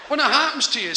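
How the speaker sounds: ordinary talk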